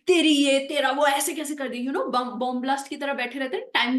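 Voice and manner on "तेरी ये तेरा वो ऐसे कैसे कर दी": loud, accusing mock outburst; angry yelling. Imitating people who scream at others all the time, angry yelling. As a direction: act out someone who is forever yelling in anger. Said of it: "जो लोग बहुत चीखते हैं ... गुस्सा करते रहते हैं".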